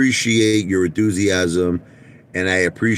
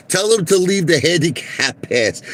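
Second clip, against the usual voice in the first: higher mock boss command; lightly voiced callous executive; mock CEO orders; 9 semitones higher than usual. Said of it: "He goes".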